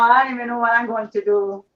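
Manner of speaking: ordinary talk, nothing marked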